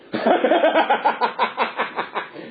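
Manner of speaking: long, high rattling laugh; imitated puppet cackle. Puppeteer shows the laugh after the prayer, long hammed-up cackle. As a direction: cackle on and on in quick bursts.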